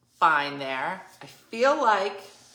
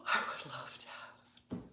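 breathy thrilled whisper; 6 semitones lower, 12 dB quieter than usual. Retold or imitated. Wendy's thrilled reply, gasped.

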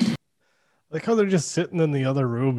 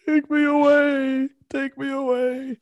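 much higher, louder, whiny mock wail; mocking whiny Elliot; 6 dB louder, slower than usual. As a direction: ham up a desperate plea to be rescued. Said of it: "Elliot going".

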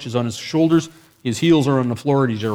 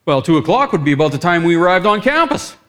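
loud, higher, excited realisation; getting all excited; 5 semitones higher, 7 dB louder than usual. Retold or imitated. Connecting his sickness to the team's arrival, all excited. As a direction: build with mounting excitement to the last word. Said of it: "I'm getting all excited now".

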